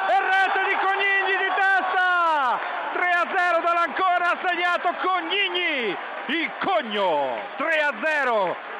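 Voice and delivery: high, loud goal commentary; breathless italian goal commentary. Real, not acted. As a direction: shout it like a goal call. Goal call.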